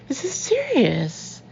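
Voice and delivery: slow it down and make him hear it; stern urgency. A narrator's reading. Scolds Ben.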